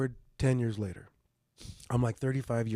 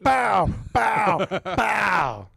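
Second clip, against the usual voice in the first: pow sound effects; 7 semitones higher, 6 dB louder, much slower than usual. Retold or imitated. Imitating downing the shots.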